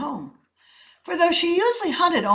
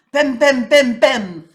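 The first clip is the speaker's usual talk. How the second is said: thumping sitting sound; 5 semitones higher, 12 dB louder, slower than usual. A narrator's reading. Animals sitting down.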